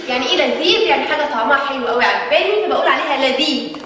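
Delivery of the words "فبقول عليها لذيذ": ordinary talk, nothing marked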